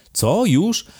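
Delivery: high, loud astonished questions; sudden surprise, pitch jumping; startled. A narrator's reading. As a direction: yelp the questions in alarm. Count startled by the time.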